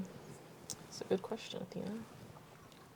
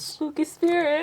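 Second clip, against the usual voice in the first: spooky voice; 9 semitones higher, 5 dB louder than usual. Pretend. Announcing spooky card name.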